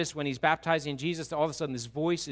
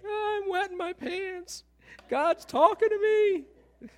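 very high, slow panicked wail; mock terror; 6 dB louder, slower than usual. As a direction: squeal it in hammed-up fright. Acts terrified Job.

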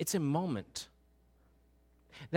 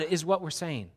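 usual speaking voice

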